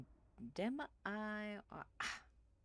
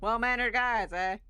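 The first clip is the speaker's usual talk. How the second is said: mocking and louder; loud sarcastic jab; 4 semitones higher, 9 dB louder than usual.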